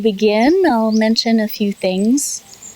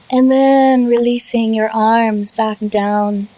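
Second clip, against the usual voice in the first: guided meditation. Real, not acted. Yoga teacher leading students through settling and self-massage, guided meditation. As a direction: draw out key words, long pauses between short phrases. Leads guided relaxation practice.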